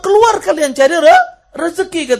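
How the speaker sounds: loud, high shouted order; Umar's command. Retold or imitated. Preacher quotes Umar sending worshippers out to work, shouted command. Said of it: "Kata Umar".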